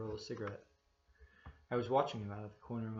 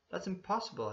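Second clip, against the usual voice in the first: higher and louder denial; incredulous protest; 8 semitones higher, 4 dB louder than usual. A narrator's reading. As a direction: flatly refuse to believe it. Narrator refuses to believe the trick.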